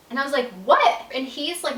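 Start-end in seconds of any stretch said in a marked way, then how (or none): 0.6-1.0 startled what. Retold or imitated. Her alarmed reply to husband.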